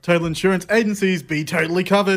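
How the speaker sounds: punchy advertising voice; ad read. Acted. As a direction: sell the slogan like a radio ad. Sponsor tagline read.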